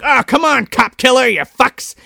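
mock cop yell. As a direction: haul the suspects out. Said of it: "they're like".

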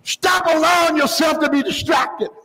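high-pitched, sustained pulpit cry; fervent preaching. Real, not acted. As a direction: preach it hard, voice up high throughout. Preacher at full force.

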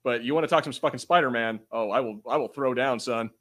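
ordinary talk, nothing marked

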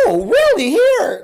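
loud, very high mock insistence. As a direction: push it on them, high and loud. Imitates pushy girls forcing snacks.

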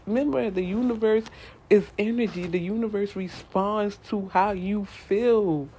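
ordinary talk

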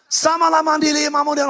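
high, loud mocking gibberish prayer; mock tongues. Pastor mocking a spooky church kid's prayer language, high and loud. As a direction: parody a spooky church kid praying in tongues. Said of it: "hyper spooky church kid".

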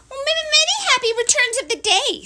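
high, quick, loud little voice; squeaky small-creature greeting; nervous eager Piglet. Acted. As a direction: blurt it with a stammer, very high.

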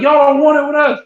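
high, cocky challenge; mock taunt. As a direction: throw it at the rival band like a dare. Band taunting rivals.

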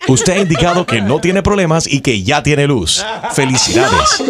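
steady automated recorded-message voice; phone menu voice. Acted. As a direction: read it like a robotic phone menu.

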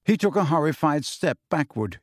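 ordinary delivery, nothing marked

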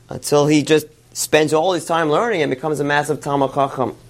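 usual speaking voice

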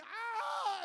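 loud, high imitated scream; full-volume shriek of fear. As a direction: mimic a kid screaming in terror. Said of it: "so he's screaming".

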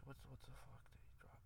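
whispered, barely audible self-questioning; muttering to self. Real, not acted. Host trying to recall what [name] dropped, whispering to himself. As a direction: drop to a whisper while searching your memory.

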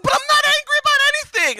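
high, loud, fast protest of innocence; defensive denial. Retold or imitated. Retelling his mentor scene, a loud indignant denial. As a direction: insist you're not angry, voice shooting up. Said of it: "I was like".

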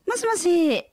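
high polite phone greeting; bright high telephone voice. Shown as a demonstration. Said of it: "do your phone voice".

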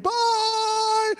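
high, long holler; yelled goodbye. Retold or imitated. Crowd waving as he looks back, yelled goodbye. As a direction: holler bye across a distance, stretched out. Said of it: "were just yelling at me".

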